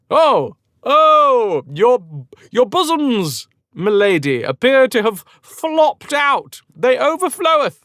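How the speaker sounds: high, mock-shocked courtly gent; hammed-up scandalized gallant; flustered gallant. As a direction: exclaim in high-pitched polite horror. Embarrassed hero addresses exposed lady.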